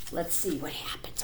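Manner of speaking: soft suspense whisper; whispered aside. Real, not acted.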